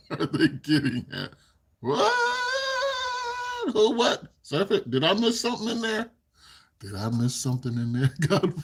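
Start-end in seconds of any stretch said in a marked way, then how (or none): 1.8-4.2 high-pitched, incredulous squeal; startled disbelief. Real, not acted. Startled by chat's message. 4.4-6.1 high-pitched incredulous question; baffled surprise. Real, not acted. Baffled by viewer's mafia remark.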